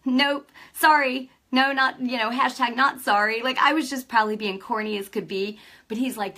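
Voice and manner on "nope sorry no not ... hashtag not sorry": louder, higher mock-stubborn refusal; playful refusal. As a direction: refuse with cheeky, punchy emphasis. Retells standing firm against son.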